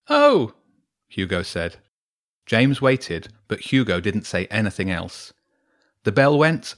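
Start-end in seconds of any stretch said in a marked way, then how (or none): 0.1-0.5 very high, loud startled oh; Hugo's surprised oh. Acted. Hugo hearing James say sorry, startled. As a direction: caught off guard.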